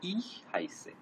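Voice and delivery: whispered "ich" with a soft breathy ch. Shown as a demonstration. Teacher whispering "ich" to show the soft ch sound. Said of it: "খটা খুব sharp হবে না".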